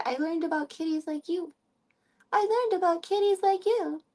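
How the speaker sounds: put-on high cooing baby talk; high, sing-song baby voice; baby talk to cat. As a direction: coo at the kitty.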